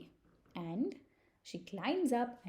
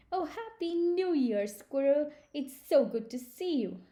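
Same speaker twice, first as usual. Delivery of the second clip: high, louder, delighted turtle reply; glad greeting; 6 semitones higher, 5 dB louder than usual. Acted. Turtle returns squirrel's New Year wish, glad greeting. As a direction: return the greeting with raised, happy voice.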